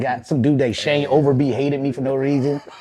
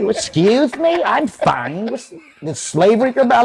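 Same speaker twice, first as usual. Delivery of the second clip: mock proper camp voice; 10 semitones higher, slower than usual. Answering the family in his new dialect.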